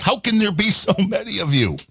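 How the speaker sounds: high-pitched mock disbelief; astonished exclamation. Host reacting to fifty chasers on one storm, astonished. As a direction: exclaim it in exaggerated amazement.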